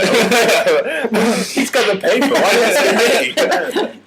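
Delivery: high, loud, slow words through laughter; laughing protest. Real, not acted. Picked to explain the blackout, he laughs out his protest. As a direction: protest while cracking up. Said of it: "laughing protest at being picked".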